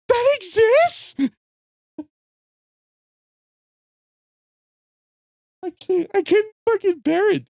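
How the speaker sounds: very high, strained squeal; squealing disbelief. Real, not acted. Telling of people who like the writing, squealing disbelief. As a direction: squeeze it out high and drawn-out, overcome with disbelief.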